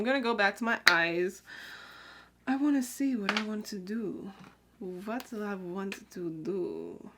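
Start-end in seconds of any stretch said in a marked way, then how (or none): none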